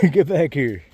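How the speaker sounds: hollering after us as the dog; voicing Sweet Pea calling out. Voicing Sweet Pea noticing we went another way, loud mock call. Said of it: "It's like".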